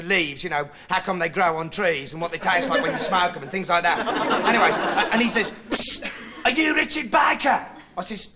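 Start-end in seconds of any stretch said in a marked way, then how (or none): 5.7-7.7 furtive hail. Acted. Bloke in bushes calls him secretively.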